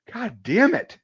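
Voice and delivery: high, loud frustrated outburst; acting out fed-up disbelief; exasperated cursing. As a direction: swing the pitch up in exasperation. Retold exasperation at another fault.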